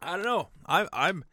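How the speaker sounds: ordinary talk, nothing marked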